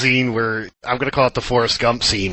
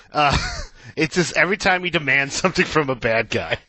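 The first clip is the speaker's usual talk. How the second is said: usual speaking voice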